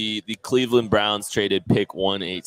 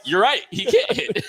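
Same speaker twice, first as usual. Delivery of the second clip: high, louder, laughing through a mock agreement; laughing quote; 4 dB louder than usual. Co-host retells agreeing with a friend, laughing. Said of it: "I was like".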